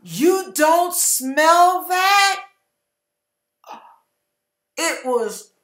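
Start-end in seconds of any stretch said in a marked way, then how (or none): 0.0-2.4 slow, higher disbelieving question; incredulous. Retold or imitated. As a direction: stretch it out in disbelief.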